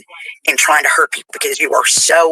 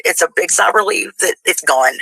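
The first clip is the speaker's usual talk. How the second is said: ordinary delivery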